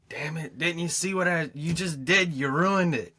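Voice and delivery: loud, high, furious yelling; angry outburst. Retold or imitated. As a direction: bark it out like a man whose thing got wrecked. Angry man in diner commotion.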